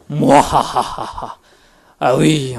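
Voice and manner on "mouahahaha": evil laugh. Acted. Villain gloats after battle.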